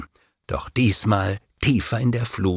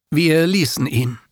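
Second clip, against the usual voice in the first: plain reading voice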